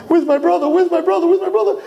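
high, rising mock panic; frantic searching. Twin pulling the cord to find his brother, frantic.

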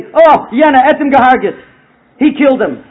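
loud, emphatic accusing voice; retold accusation. Storyteller voices people at the lady's house, loud accusation. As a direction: imitate people loudly pointing blame.

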